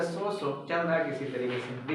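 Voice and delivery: quiet, half-hearted so-so; mumbled so-so reply. Retold or imitated. Student asked how he is, quiet mumbled reply. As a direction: mumble it like you barely care.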